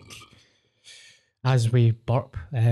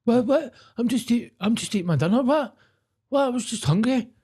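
much higher, whiny brother protesting innocence; mock innocent brother; 10 semitones higher than usual. Mimicking the brother caught eating noisily, high and indignant. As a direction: play the baffled brother, pitched way up.

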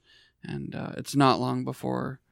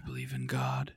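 mock solemn; 12 semitones lower, 8 dB quieter than usual.

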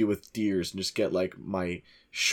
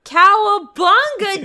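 surfer cry; 11 dB louder, slower than usual. Pretend. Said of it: "do surfers say something?".

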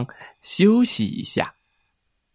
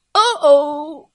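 high pained groan; 3 dB louder than usual. Acted. Lobster hurts back.

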